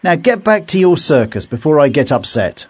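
irritated warning. A narrator's reading. Hank warns off the knight.

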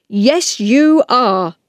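angry storybook man; cross man. Acted. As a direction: contradict them firmly in a scolding voice. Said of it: "shouted at them".

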